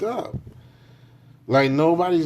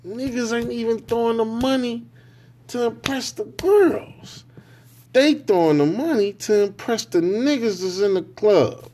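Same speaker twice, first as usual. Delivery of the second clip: high incredulous rant; 9 semitones higher than usual. Real, not acted.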